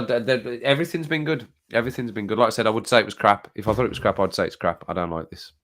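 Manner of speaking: ordinary talk, nothing marked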